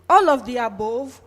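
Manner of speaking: can't believe everyone picked all of them; surprised question. Real, not acted. Reacts to poll result in disbelief.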